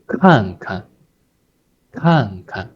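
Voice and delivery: 看看 with falling first, light second; gentle second syllable. Shown as a demonstration. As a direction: stress the first 看, let the second 看 drop lightly. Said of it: "the second one is a gentle tone".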